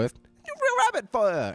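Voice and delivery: put-on high slow New York accent. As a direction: drawl it like a New Yorker. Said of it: "in a real New York, New York accent".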